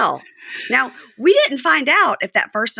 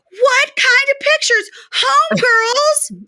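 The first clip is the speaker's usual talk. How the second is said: much higher, louder, slowed mock outrage; voicing Joy outraged; 4 dB louder, much slower than usual. Podcaster as Joy shrieking at Red about pictures. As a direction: shriek it out like a jealous girlfriend.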